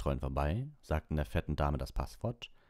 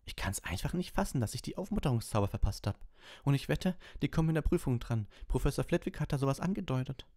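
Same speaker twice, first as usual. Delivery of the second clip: high, agitated student voice; fretting student; 9 semitones higher than usual. Acted. Student frets over a missed lesson before the exam, high and agitated. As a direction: voice a worried pupil, pitched well up.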